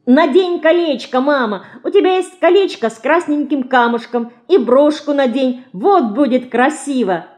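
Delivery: put-on high, eager child's cry; excited shout. A narrator's reading. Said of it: "закричала Динка". (радостно кричит детским голосом)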